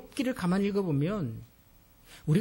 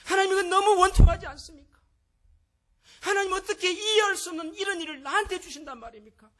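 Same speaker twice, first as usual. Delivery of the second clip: much higher, louder pained lament; crying out to God; 12 semitones higher, 7 dB louder than usual. Shown as a demonstration. Modelling a lament to God for the congregation, much higher anguished cry. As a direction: pour out the grievance to God. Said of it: "하나님 앞에서 하세요".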